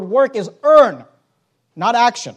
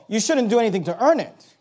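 ordinary talk, nothing marked

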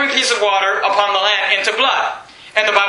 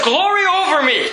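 high, bright exclamation of triumph; excited Moses; 7 semitones higher than usual. Acted. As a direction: burst out in delight. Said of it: "Moses is excited".

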